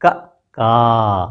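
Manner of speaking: क short then का long and drawn out; क clipped, का held open from the throat; short and long vowel demo. Shown as a demonstration. Teacher contrasts the vowels for pupils, short then long. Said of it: "कंठ से आ रही है".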